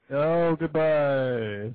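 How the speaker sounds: very slow, stretched-out goodbye; drawn-out farewell. Pretend. Host hanging up on a wailing caller, long drawn-out goodbye. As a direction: draw each word way out in a playful send-off.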